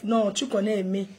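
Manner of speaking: usual speaking voice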